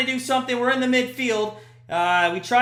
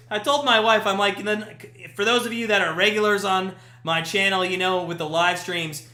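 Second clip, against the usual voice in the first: ordinary delivery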